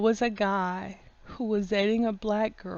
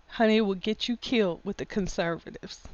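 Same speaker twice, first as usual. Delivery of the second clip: ordinary talk, nothing marked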